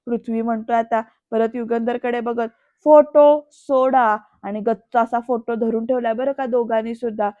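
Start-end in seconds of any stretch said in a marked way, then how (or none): 2.8-4.2 loud, slow acted command; forceful demand. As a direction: order them to let go, word by word. Acted stand-off over the photo.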